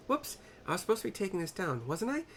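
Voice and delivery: put-on high, fast flustered young voice; squeaky clumsy scribe catching herself; Nashu voice. Nashu realizes she should be taking notes, high and flustered. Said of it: "says Nashu".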